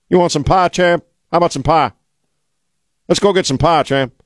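lightly voiced grizzled old cowboy offering pie; Tommy Lee Jones impression. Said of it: "a fairly decent Tommy Lee Jones imitation".

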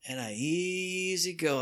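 soft, slow, sighing ease; soft drawn-out contented voice; relaxed sigh. Retold or imitated. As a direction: melt into the couch, slowly and softly. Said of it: "I lean back ... and I go".

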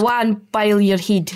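Scottish "Awa and boil your head", awa for away; Scottish brogue, rolling and blunt; Scottish accent. Shown as a demonstration. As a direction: say it like a Glaswegian telling someone off. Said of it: "do us the best".

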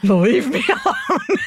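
half-laughing mock exasperation; laughing mock retort. Tree shopper fending off the helper, laughing mock exasperation.

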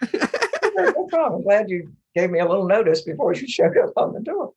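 high, loud reply through laughter; laughing mock agreement. Mother answering her son's surprise visit, laughing mock agreement. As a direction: play the put-upon mom, cracking up.

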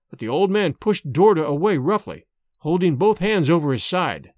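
ordinary delivery

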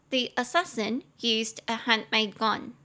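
slow, careful English model sentence; slow model reading. Shown as a demonstration. Teacher reading the model sentence aloud to students, slow and clear. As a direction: read it slowly and clearly for learners. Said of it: "你就可以跟外国人说".

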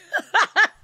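high, loud burst of laughter. Real, not acted. Laughs after ribbing teammates.

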